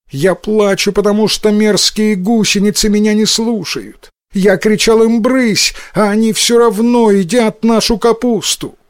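high-pitched, upset little complaint; mildly tearful child. A narrator's reading. Boy complains to mother that caterpillars won't listen, mildly tearful. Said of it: "он расплакался".

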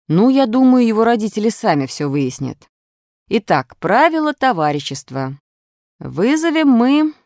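a touch of schoolmasterly deliberation; teacher resuming lesson. A narrator's reading. Reader plays the teacher.

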